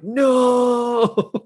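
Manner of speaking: long high-pitched drawn-out cry; mock scream. Amy running back from freezing ocean, mock scream. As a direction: hold it high, imitating a screamed refusal. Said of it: "come back screaming".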